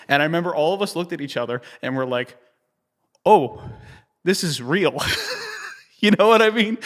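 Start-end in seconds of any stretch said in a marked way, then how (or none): 3.2-5.0 stunned realization. Retold or imitated. Realizing it is the final. 5.0-6.8 laughing. Real, not acted. Laughing aside to the listener.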